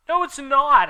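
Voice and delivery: high, loud upset yell; Ebony shouting at Vampire; angry shout. Acted. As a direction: snap back in anger. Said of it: "I shouted angrily".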